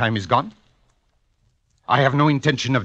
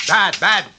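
angry scolding; 9 semitones higher, 5 dB louder, slower than usual. Acted. Maestro scolding a sloppy bout.

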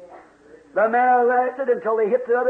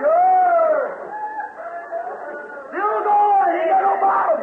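ecstatic shouting; 8 semitones higher, much slower than usual. Real, not acted. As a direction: cry it out in full fervour. Preacher joining the shout.

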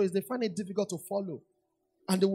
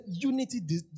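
ordinary talk, nothing marked

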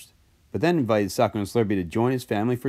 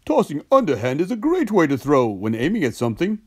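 higher, explaining cartoon voice; Socko; 6 semitones higher, 3 dB louder than usual. A narrator's reading. Socko explains underhand throwing, higher character voice. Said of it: "said Socko".

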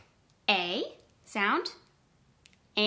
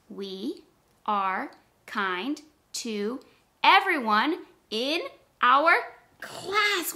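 word by word; 3 semitones higher, 4 dB louder, slower than usual. Shown as a demonstration. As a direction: count it out slowly, ending big. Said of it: "Let's count that one".

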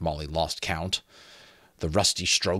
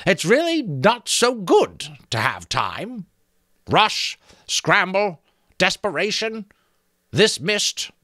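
high, musing creaking voice; reflective skull; 12 semitones higher, 5 dB louder than usual. A narrator's reading. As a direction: ponder it aloud like an old branch creaking. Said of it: "replied reflectively".

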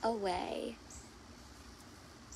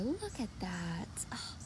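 tender whisper; 5 semitones lower, 8 dB quieter than usual. Acted. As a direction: hush as if not to wake him. Tender moment in the story.

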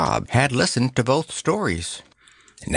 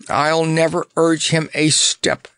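firm declaration; 7 semitones higher than usual. A narrator's reading. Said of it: "declared Notta firmly".

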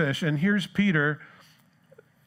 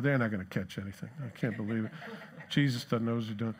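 low, muttered scoff, softer; scoffing onlookers; 5 semitones lower, 8 dB quieter, faster than usual. Retold or imitated. Crowd on the shore doubting Jesus, muttered scoff. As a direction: mutter doubt like a sneering bystander.

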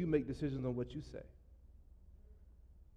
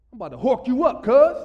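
higher, louder mock tough-guy threat; 7 semitones higher, 13 dB louder than usual. Pastor hamming up an insult to the congregation, mock menace. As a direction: play the street tough, threatening loud. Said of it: "I can't even say it with a straight face".

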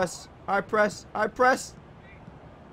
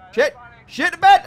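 shout; 12 semitones higher, 11 dB louder than usual. Real, not acted. Frustrated yell.

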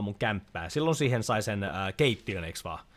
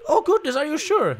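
much higher, louder hammed-up shocked lady voice; mock prissy gasp; 9 dB louder than usual. Player voicing a startled villager, hammed-up high gasp. Voicing a game character's reply.

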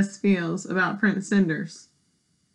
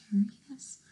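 hushed unvoiced whisper; 10 dB quieter, much faster than usual. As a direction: whisper as a reveal. Aside to listeners.

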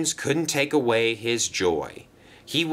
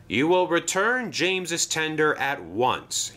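firm, commanding order; a strict boss laying down the law; stern; 6 semitones higher than usual. A narrator's reading. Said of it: "he said sternly".

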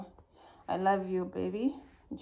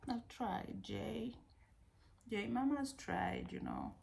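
sighing; 3 semitones higher, 13 dB quieter than usual. Real, not acted. Exhausted sigh to the baby.

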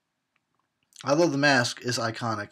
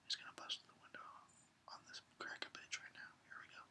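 hushed, breathless anticipation; real suspense whisper; tense whisper; 22 dB quieter, faster than usual. Real, not acted. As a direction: whisper as the scare builds.

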